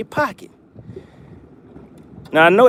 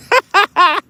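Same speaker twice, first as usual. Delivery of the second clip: high laugh; 12 semitones higher, 4 dB louder than usual. Real, not acted. Laughing at Chicken Hawk's loss.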